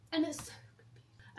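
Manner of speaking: a hushed confession; whispered aside. Real, not acted. Telling viewers about the mall in a whisper. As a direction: whisper it, like a secret.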